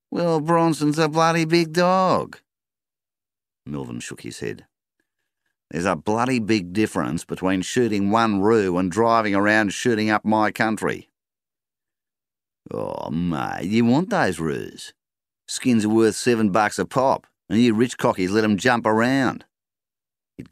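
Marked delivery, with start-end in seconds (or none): 0.1-2.4 much higher, slow, cheeky; shooter's voice. A narrator's reading. Roo shooter cheekily excusing himself, high slow voice. 5.7-11.1 angry retort. A narrator's reading. Farmer confronts shooter. 12.7-19.5 higher, louder, mocking; shooter's voice. A narrator's reading. Roo shooter mocking the farmer, higher louder voice.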